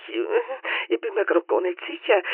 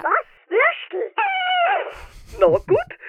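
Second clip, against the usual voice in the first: excited; slower than usual. Acted. Dog excited about sausage.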